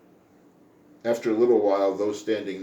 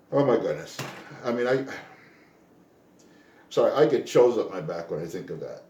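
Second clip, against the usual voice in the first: ordinary talk, nothing marked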